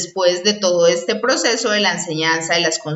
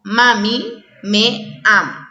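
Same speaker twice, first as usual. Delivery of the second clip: slow example sentence; slower than usual. Shown as a demonstration. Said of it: "tales como".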